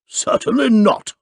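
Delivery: indignant refusal, high and loud. A narrator's reading. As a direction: snap it back, offended. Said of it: "answered Mister Audley indignantly".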